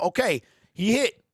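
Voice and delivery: ordinary talk, nothing marked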